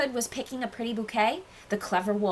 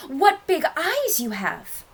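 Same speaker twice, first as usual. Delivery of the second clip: high, drawn-out wonder; amazed child; 7 semitones higher, slower than usual. A narrator's reading. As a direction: stretch it out slowly in high-pitched astonishment. Child amazed at changed grandmother.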